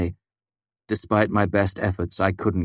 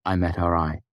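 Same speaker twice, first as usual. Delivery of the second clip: plain reading voice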